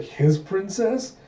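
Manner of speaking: high, drawn-out mock disbelief; shocked. Lord hearing the guard's claim, shocked. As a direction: echo it slowly as a rising, stunned question. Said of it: "staring in shock".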